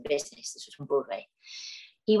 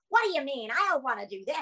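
higher, protesting American stage voice; put-on stage American accent; 5 semitones higher than usual. Actress suddenly in role before her co-star, American accent. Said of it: "British accent. And the next minute I'm going".

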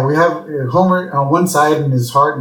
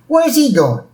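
higher-pitched questioning kid, lightly voiced; kid asking; 12 semitones higher than usual. Teenager asks the attendant about Homer, lightly voiced curious kid. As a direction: ask it as a curious youngster.

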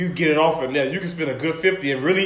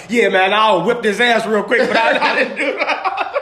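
raised, louder mock brag breaking into laughter; laughing imitation; 6 semitones higher, 4 dB louder than usual. Cohost imitating a podcast regular for laughs, laughing mock boast.

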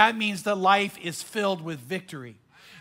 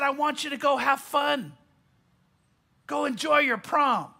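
raised, joyful send-off call; cheerful uncle; 4 semitones higher than usual. Retold or imitated. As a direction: cheer them on to the prom. Cheerful uncle sends them off.

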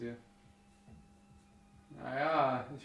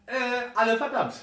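raised accusing voice; 11 semitones higher, 10 dB louder than usual. Acted. Game master voices angry NPC.

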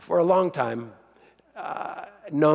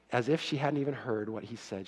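quiet repeat; 3 semitones lower, 8 dB quieter than usual. Shown as a demonstration. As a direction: repeat the phrase softer, sinking.

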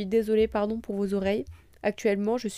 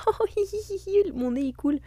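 whiny; 10 semitones higher than usual. Retold or imitated. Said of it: "J'étais là en mode".

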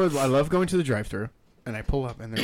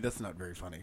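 lower, much softer flat reply; deadpan unamused; 8 semitones lower, 12 dB quieter, faster than usual. Retold or imitated. Annoyed drive-thru worker not finding the joke funny, low and soft. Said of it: "and they're like".

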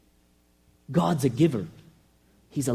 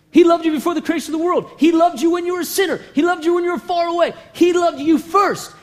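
rising, louder, faster preaching; preaching at full force; 6 semitones higher, 3 dB louder than usual. Real, not acted. Preacher to congregation, building to a fervent shout. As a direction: drive it home to the congregation.

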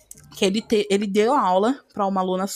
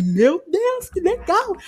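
high, louder burst of delight; amazed reaction; 9 semitones higher, 4 dB louder, slower than usual. Retold or imitated. Student recalling learning that deaf people feel vibrations, amazed. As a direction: exclaim it high, thrilled. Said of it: "Eu fiquei".

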